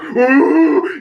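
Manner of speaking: imitated high, loud battle roar; Punisher roar. Fan imitating Frank after the whisper, loud roar. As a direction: let out a mock action-hero roar. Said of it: "oh, the Punisher roar".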